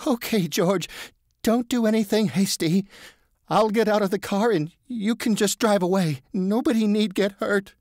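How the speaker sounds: high, careful placating voice; frightened pleading. A narrator's reading. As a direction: talk the gunman down gently. Marvin at gunpoint.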